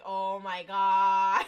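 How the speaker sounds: loud, drawn-out exasperated groan; mock exasperation. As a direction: drag it out slowly, loudly, imitating disbelief. Exasperated reaction to camera guy.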